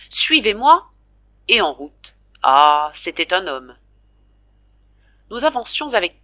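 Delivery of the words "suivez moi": firm order. A narrator's reading. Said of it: "dit aux femmes".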